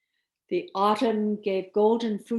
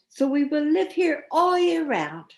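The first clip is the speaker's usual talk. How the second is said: high, loud triumphant frost; gleeful cry; 7 semitones higher, 4 dB louder than usual. Acted. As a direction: crow it like winter spirits claiming the garden. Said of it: "they cried".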